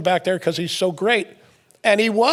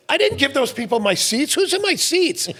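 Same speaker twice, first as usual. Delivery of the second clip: higher mock complaint; indignant coach; 8 semitones higher than usual. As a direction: protest like the fussy coach. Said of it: "he goes".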